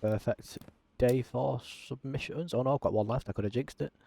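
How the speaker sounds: ordinary delivery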